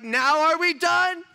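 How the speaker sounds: high, slow, very loud demand; full-volume retold ultimatum; exasperated yell. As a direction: shout it slowly, at the end of your patience. Second, escalated retold confrontation.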